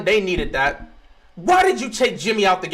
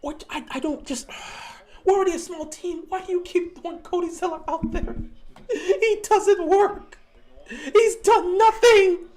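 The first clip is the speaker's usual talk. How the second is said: higher, strained laugh-cry whine; laughing despair; 9 semitones higher than usual. Real, not acted. Fan whining at the coach about Zeller, laugh-crying. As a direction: laugh through the frustration.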